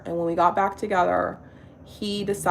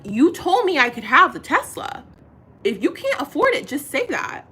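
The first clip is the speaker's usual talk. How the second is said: raised, higher voice reliving the fight; retold angry retort; 6 semitones higher, 5 dB louder than usual. Woman recounting telling boyfriend off, heated. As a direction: snap back at him.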